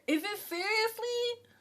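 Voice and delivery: loud, high, drawn-out outburst; about to yell. Real, not acted. Streamer overcome on live, bursting out loudly. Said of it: "I'm about to yell".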